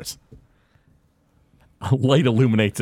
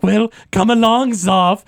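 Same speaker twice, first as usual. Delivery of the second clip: louder, brisk summons; commanding professor; 8 semitones higher, 6 dB louder, slower than usual. Acted. Professor orders Zoff to come along, louder and brisk. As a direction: call the apprentice to follow.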